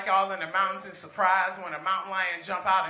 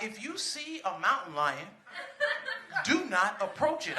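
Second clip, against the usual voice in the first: newscaster; 7 dB quieter than usual. Retold or imitated. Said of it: "the newscaster was like".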